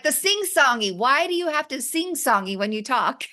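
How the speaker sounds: high, lilting sing-song imitation of a complaint; sing-songy. Imitating husband's complaint about her talking, sing-songy. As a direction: mimic a teasing sing-song, pitched well up. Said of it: "You sound like you're singing when you're talking".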